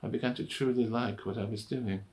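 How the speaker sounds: ordinary talk, nothing marked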